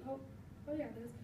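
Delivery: ordinary talk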